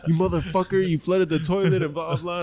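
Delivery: high-pitched mock tirade from dad; retold angry father, voice pitched well up; imitating angry dad. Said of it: "super angry".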